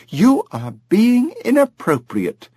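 high, slow, offended mother; indignant rebuke. A narrator's reading. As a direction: put the boy in his place.

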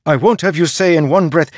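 high, loud and fast; mother scolding the farmer, fast and loud; angry mother. A narrator's reading. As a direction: rush the rebuke at him. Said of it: "Mother said".

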